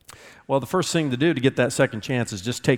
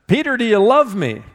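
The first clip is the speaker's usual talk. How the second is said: higher, louder third asking; Jesus asking Peter again, raised; pressing question; 7 semitones higher, 5 dB louder than usual. Acted. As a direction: press him a final time. Jesus asks third time.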